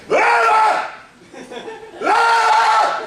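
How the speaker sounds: very high, short mock scream; imitated woman's scream. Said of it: "a woman screaming like this".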